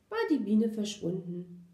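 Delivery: ordinary delivery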